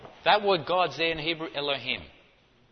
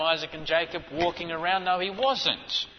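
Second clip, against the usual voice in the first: ordinary talk, nothing marked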